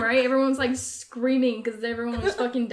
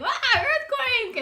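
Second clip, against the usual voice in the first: mock panic cry; 13 semitones higher, 4 dB louder, much slower than usual. Alarm in dream.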